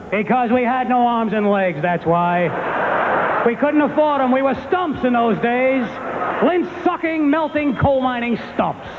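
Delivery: slow, high-pitched dad tirade; ranting father. Retold or imitated. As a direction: build an absurd hard-luck rant, hammed up. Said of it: "My father'd be like".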